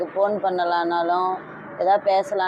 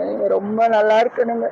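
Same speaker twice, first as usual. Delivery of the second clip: tearful blessing, voice higher and louder; crying; 4 semitones higher, 5 dB louder than usual. Real, not acted. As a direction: bless him through real tears. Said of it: "அழுவாதீங்க, அழுவாதீங்க".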